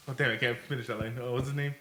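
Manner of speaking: ordinary delivery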